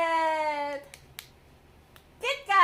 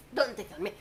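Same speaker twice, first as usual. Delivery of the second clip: usual speaking voice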